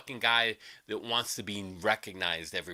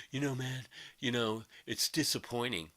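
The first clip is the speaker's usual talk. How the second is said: mocking soft whine; 6 dB quieter than usual. Mocking Brad's complaint.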